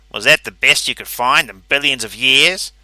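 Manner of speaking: loud, scornful jeer; mocking rival. A narrator's reading. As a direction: taunt him in front of the crowd. Calverley mocks rival.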